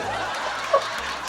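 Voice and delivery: breathy, wheezing laughter; real laugh. Real, not acted. Host tickled by Fred reselling trash, real laughter. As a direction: crack up helplessly at the punchline. Cracks up at retold punchline.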